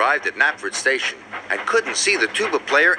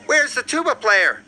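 high-pitched worried wail; 10 semitones higher than usual. A narrator's reading. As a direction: band leader crying out in mild dismay. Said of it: "he wailed".

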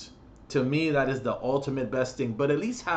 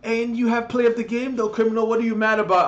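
high, whiny mock voice; mocking teammates; 8 semitones higher, 5 dB louder than usual. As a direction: play the clueless teammate, nagging. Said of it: "People are like".

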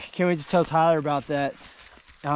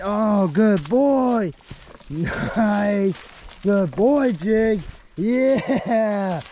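high, drawn-out praise, louder and stretched; excited praise; 5 semitones higher, 3 dB louder, much slower than usual. Real, not acted. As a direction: gush over the dog, slow and sing-song. Dog finds another bone, praising.

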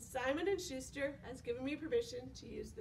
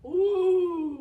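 ooh of wonder; 3 semitones higher, 13 dB louder than usual. Acted. Teacher marvels at next animal.

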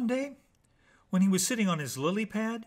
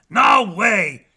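loud refusal; 5 semitones higher, 13 dB louder than usual. Acted. Wolf refuses Jack.

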